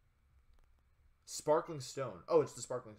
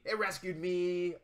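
mocking slow; 3 semitones higher, much slower than usual. Shown as a demonstration. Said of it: "you wanna make me say it again".

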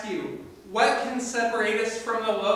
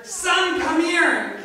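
much higher, loud welcoming shout; overjoyed call; 8 semitones higher, 10 dB louder, much slower than usual. Acted. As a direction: throw your arms open and call. Father embraces returning son.

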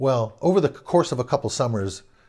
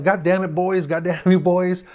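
higher, raised mock yell at the boys; imitated yelling; 5 semitones higher than usual. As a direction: shout them down, half-acted. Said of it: "He was yelling".

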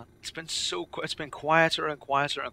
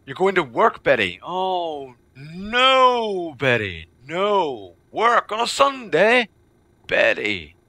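high, loud cry of sympathetic dismay; mock dismay; 7 semitones higher, 8 dB louder, much slower than usual. Real, not acted. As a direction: wail over her working on a Sunday. Dismay Betty works Sunday.

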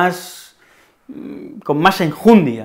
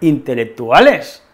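harto y exasperado; 4 semitones higher, 3 dB louder than usual. Acted. Discusión fingida con el personaje.

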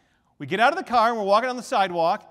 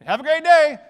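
breezy farewell; 7 semitones higher, 11 dB louder than usual. Retold or imitated. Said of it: "And I just said".

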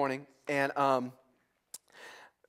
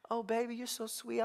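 soft, higher cooing; baby talk; 4 semitones higher, 10 dB quieter than usual. Retold or imitated. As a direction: coo over the baby girl.

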